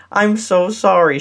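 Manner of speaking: usual speaking voice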